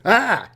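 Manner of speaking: high, loud startled yelp; alien's scream. Retold or imitated. Blue ant alien seeing a human, startled shriek. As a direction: shriek in sudden fright. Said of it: "And he's like".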